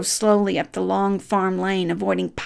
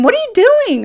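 high, louder playful yell at the dog; mock shout; 4 dB louder, much faster than usual. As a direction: call out at the running dog in mock alarm. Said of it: "I would shout at him".

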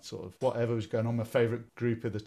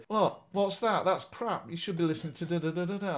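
mocking school kids; 8 semitones higher, 3 dB louder than usual. School kids mocking his music.

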